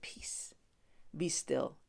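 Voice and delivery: whispered calm rebuke; hushed command. Acted. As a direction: hush the storm softly. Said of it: "rebuked the wind".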